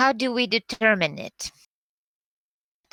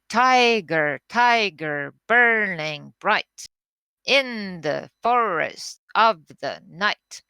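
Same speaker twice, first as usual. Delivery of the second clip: "Tiger, tiger" loud and falling, "burning bright" slowed; strong-weak beats, pressed home slowly; poem recited to show the trochee; trochaic recitation; 4 dB louder, slower than usual. Shown as a demonstration. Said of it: "To illustrate".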